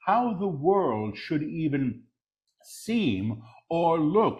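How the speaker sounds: deliberate full-voice repeat; measured proclamation. Real, not acted. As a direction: proclaim it clearly, word by word. Same words repeated in full voice.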